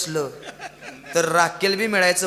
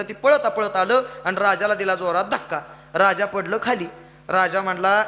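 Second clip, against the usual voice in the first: ordinary delivery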